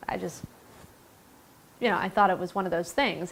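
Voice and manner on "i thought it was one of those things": ordinary talk, nothing marked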